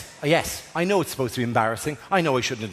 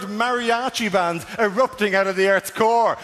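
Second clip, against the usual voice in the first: ordinary talk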